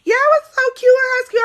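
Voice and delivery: put-on high squealing excited girlfriend; excited friend. Carla teasing the storyteller about her ex, squealing high. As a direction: pitch it way up like a thrilled friend. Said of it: "Carla all excited".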